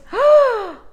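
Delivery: sharp high gasp of joy; delighted gasp. Real, not acted. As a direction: gasp at the card you love. Turning over a favorite card.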